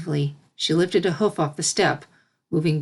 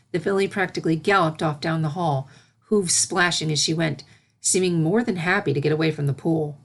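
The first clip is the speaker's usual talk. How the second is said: usual speaking voice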